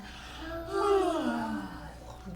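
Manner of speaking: soft drawn-out pretend yawn; mock yawn. Shown as a demonstration. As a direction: yawn big for the kids. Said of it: "you can yawn".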